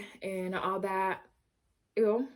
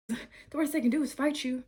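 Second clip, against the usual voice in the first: breathless from dancing; out of breath; 4 semitones higher than usual. Real, not acted. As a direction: talk while out of breath. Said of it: "sorry, I'm out of breath".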